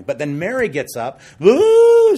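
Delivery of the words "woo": a sudden high, loud mock wail; mock wailing cry. Preacher mimics Mary wailing as she leaves, mock wail. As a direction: wail like a weeping woman, hammed up. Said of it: "She's crying".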